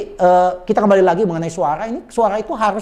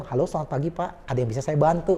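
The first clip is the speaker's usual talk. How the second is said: soft, courteous phone greeting; polite service greeting; 3 semitones lower, 8 dB quieter than usual. Shown as a demonstration. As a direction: offer help gently and politely. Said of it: "Anda dengan sopan".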